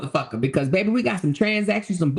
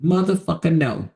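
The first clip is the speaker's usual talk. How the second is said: normal talking voice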